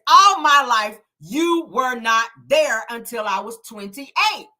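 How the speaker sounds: loud burst on "All my life", then slow; drawn-out "you were not there", mock accusing; emphatic retold accusation. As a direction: hit "All my life" hard, then stretch "twenty-eight". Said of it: "she says it like that".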